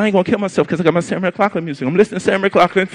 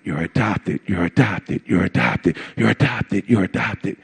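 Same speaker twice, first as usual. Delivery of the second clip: echoing low repeat; 11 semitones lower, 5 dB quieter than usual. Retold or imitated. Parents' news repeating in head.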